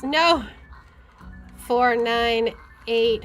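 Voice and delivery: usual speaking voice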